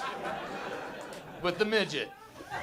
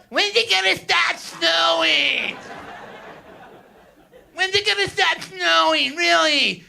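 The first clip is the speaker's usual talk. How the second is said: whiny local; 7 semitones higher than usual. Retold or imitated. Comic mocking locals sick of snow, high whining shout. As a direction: moan like a snowed-in complainer. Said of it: "Whiny, just whiny".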